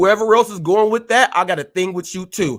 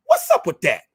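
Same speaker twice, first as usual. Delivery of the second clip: hurricane catchphrase. Retold or imitated. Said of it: "In the words of the hurricane".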